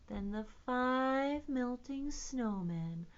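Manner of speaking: expressive, playful rhyme recital; sing-song read-aloud with varied energy; children's rhyme. Shown as a demonstration. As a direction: act out each line for little listeners.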